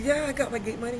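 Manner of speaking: high, keen acted reply; eager phone answer. Telling his friend he has the money, eager. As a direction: answer a buddy all keyed up.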